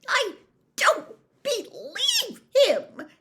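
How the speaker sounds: high, loud frantic rant; feverish. A narrator's reading. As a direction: spill it out in a frenzy. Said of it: "she said feverishly".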